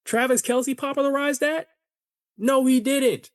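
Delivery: incredulous. Acted. Podcast host scoffing at credit for the fade, incredulous. Indignant riff on a haircut claim.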